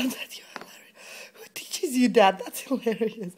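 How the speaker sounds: ordinary talk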